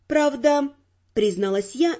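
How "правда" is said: ordinary delivery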